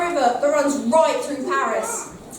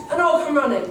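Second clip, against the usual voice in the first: ordinary talk, nothing marked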